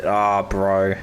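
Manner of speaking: usual speaking voice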